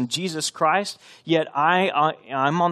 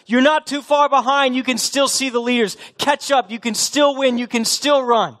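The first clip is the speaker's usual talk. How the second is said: high, loud urgent plea; Paul pleading; 10 semitones higher, 5 dB louder than usual. Retold or imitated. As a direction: urge lagging runners to get back in the race. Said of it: "and he's saying".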